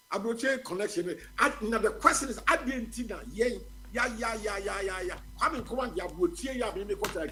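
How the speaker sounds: ordinary delivery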